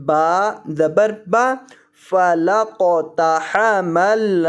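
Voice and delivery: very slow, measured tajweed chant; syllable-by-syllable spelling drill. Shown as a demonstration. Teacher building the word for students, slow drawn-out recitation. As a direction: spell each letter, then recite the joined syllables slowly.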